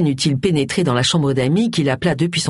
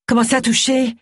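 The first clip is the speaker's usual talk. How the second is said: higher-pitched, put-out retort; indignant; 9 semitones higher than usual. A narrator's reading. Roy tells Agatha a fish pulled him in, indignant. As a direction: protest crossly in a raised pitch.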